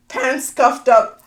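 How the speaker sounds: ordinary talk, nothing marked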